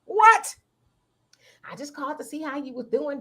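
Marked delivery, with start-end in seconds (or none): 1.6-3.2 much softer, fast mumble; sheepish caller; soft quick aside. Retold or imitated. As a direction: slip it in softly and quick.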